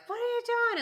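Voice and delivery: high-pitched imitation of an irate driver; mock yell. Honking driver to woman backing out, mock yell. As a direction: shoot the pitch right up, mock outrage.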